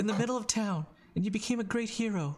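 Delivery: high, halting, earnest voice; Danny. Acted. Danny pleading for Voldo's trust, high and earnest. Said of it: "she goes".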